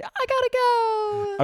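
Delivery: slow, high squeak; high squeaky voice. Retold or imitated. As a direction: squeak it out, drawn out. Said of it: "and I said".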